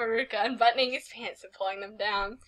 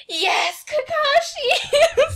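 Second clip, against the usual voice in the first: mock cry of the name, high, loud and drawn out; hammed-up cry out; 6 dB louder, much slower than usual. Reading the fanfic's climax aloud, hammed-up and laughing. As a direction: ham up the cry, laughing through it. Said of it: "He cried out".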